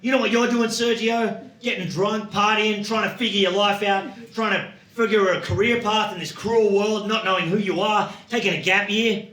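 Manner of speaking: high, mock-indignant tirade; indignant rant. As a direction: lecture a young co-worker in exasperation. Acted rant at young backpacker.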